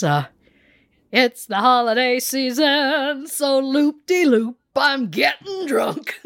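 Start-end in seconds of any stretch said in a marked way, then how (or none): 1.1-6.2 high, slow, sing-song tipsy voice; Broadway Lauren impression. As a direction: ham it up like a tipsy Broadway star. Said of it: "I don't know if I can do it justice".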